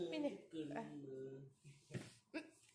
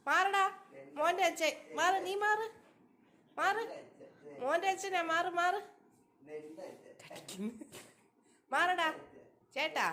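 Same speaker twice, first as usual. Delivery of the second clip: playful shoo; 6 semitones higher than usual. Real, not acted. Pushing brother away playfully.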